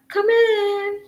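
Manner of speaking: high call of come in at normal volume. Retold or imitated. Retelling the call to the delivery man, high. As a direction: call it out high again to the door. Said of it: "'cause I went".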